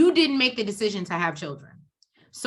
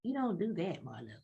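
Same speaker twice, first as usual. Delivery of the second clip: quiet retelling; 3 semitones lower, 12 dB quieter than usual. Retold or imitated. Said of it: "And Kandi's like".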